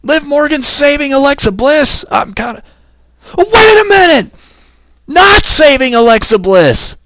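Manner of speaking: surprised shout. Real, not acted. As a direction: react to the sudden run-in. Sudden run-in surprise.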